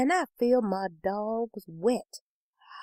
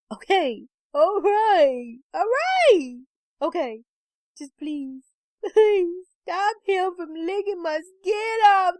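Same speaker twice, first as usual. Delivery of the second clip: high, desperate pleading; tearful begging; 9 semitones higher, slower than usual. A narrator's reading. Crying wife begging husband to stop the dog, high and desperate. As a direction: give in, then beg in a rising whine.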